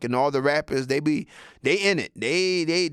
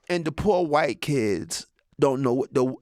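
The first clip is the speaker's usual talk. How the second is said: usual speaking voice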